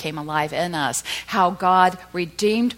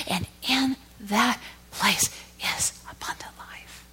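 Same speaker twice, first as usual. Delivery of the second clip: slow, softer whisper; hushed whisper; 5 semitones higher, 3 dB quieter, slower than usual. Acted. Preacher whispering the climax to the church, hushed. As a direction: let it fall to a hush.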